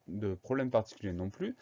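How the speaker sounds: usual speaking voice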